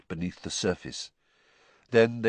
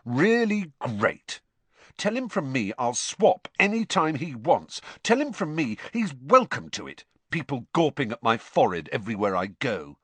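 bitter sarcasm; 6 semitones higher, 3 dB louder than usual. A narrator's reading. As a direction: drag out the sarcasm. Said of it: "said Harry bitterly".

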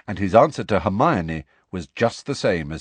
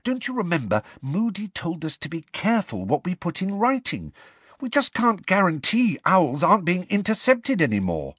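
lightly voiced young girl; 10 semitones higher than usual. Hermione voiced by narrator.

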